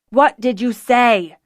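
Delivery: loud, slow demand; insistent. A narrator's reading. Zennor presses Fiona angrily, persistent. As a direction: press hard for an answer. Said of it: "Zennor persisted".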